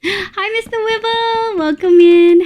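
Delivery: high, drawn-out welcome; greeting a viewer. Real, not acted. Streamer welcoming a viewer, high sing-out greeting. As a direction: greet them high and lingering.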